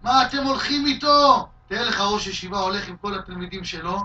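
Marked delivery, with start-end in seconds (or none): 0.0-1.5 loud, high jeering yell; mock shout. Shimei shouting at David's followers, loud jeering yell. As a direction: jeer at the followers loudly. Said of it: "הוא צועק".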